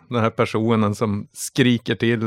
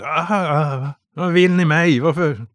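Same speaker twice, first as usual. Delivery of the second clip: a touch of a frightened prisoner, higher; mildly voiced cornered cultist; scared captive; 4 semitones higher than usual. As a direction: plead with the captors, pitch up.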